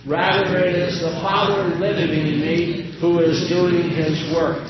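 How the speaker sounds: solemn Bible reading; scripture reading. Acted. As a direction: read the gospel to the congregation. Reads scripture aloud.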